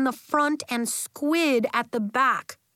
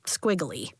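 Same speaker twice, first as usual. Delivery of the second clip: ordinary delivery, nothing marked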